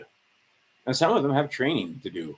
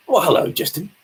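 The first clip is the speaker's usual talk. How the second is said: louder, playful put-on "well, hello"; a hammed-up welcome for a newcomer; put-on greeting; 6 semitones higher, 7 dB louder than usual. Greeting viewer Justin.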